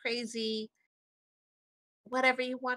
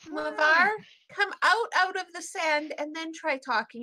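calling out; 4 semitones higher, 7 dB louder than usual. Acted. Tika calls to Mavar.

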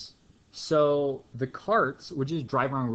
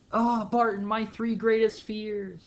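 much higher, louder theatrical dismay; mock dread; 9 semitones higher, 3 dB louder than usual. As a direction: wail about fractions like a melodrama. Dramatic dread of fractions.

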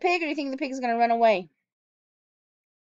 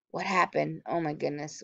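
usual speaking voice

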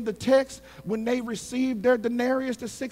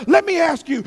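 ordinary talk

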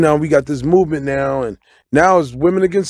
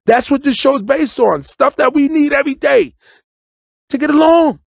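preaching it; 8 semitones higher than usual. Real, not acted. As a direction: lift it like a sermon. Emphatic raised preaching.

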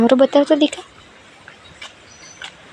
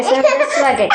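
laughing; 9 semitones higher than usual. Real, not acted. Laughing answer about toddler.

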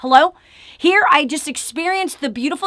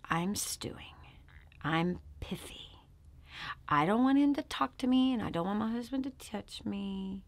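low hushed whisper; hushed confession; 5 semitones lower, 15 dB quieter than usual. Real, not acted. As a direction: confide it barely aloud.

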